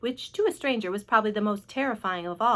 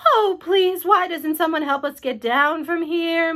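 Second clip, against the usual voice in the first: high, loud plea; calling out; 6 semitones higher, 7 dB louder, slower than usual. A narrator's reading. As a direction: call pleadingly for help, drawing it out. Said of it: "Miss Spider called out".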